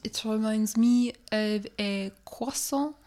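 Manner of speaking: put-on French-accented English with filler uhs; mock French accent. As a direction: play a French speaker searching for English. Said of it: "get my French accent".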